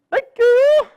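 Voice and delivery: very high, loud singsong squeal; grandma character. Acted. Grandma thanking the storekeeper, high loud singsong. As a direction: trill it out far above your own voice.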